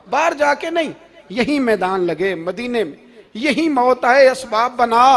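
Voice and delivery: loud, urgent sermon plea; impassioned preaching. Acted. Preacher voicing Umar's prayer to die in Medina, loud and impassioned.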